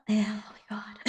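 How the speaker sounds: breathy whispered oh my god and a giggle. Real, not acted. Nervous singer whispers to herself before the next song, whispered giggle. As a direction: whisper it under your breath, then laugh.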